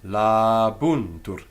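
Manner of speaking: "Labuntur" slow, La and bun held long; long vowel. Shown as a demonstration. Teacher repeating the example, "Labuntur" with long a. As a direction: stretch the first two syllables of "Labuntur". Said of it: "same applies there".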